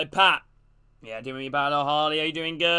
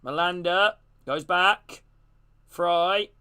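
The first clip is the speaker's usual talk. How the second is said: ordinary talk, nothing marked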